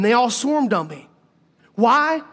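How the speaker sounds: ordinary talk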